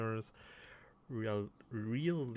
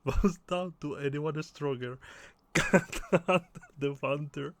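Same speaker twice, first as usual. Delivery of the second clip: loud, higher voice breaking into laughter; laughing while reading; 4 semitones higher, 7 dB louder than usual. Real, not acted. Player reading game joke aloud, laughing hard. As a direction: push the line out through real giggles.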